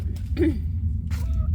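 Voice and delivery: sharp high throat clear; loud throat clear. Real, not acted. Reader clearing throat before going on, loud.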